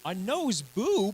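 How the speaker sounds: slow, high disbelieving echo; incredulous. Real, not acted. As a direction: repeat it in utter disbelief. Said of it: "I think that was a nose boop".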